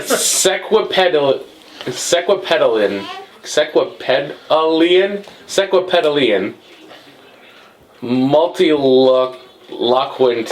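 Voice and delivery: sequipedalian sounded out slowly, syllable by syllable; careful sounding out. Shown as a demonstration. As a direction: spell out sequipedalian slowly, one syllable at a time. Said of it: "no fucking way I'm gonna be able to pronounce this".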